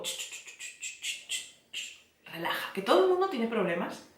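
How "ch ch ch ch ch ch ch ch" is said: soft shushing hiss to calm herself. Shown as a demonstration. Calming her own dramatic thoughts with a soft shush. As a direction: shush your racing mind gently. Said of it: "es como".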